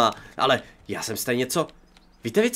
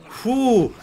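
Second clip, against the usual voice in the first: relieved phew; 10 semitones higher, 4 dB louder than usual. Real, not acted. Relief after fight.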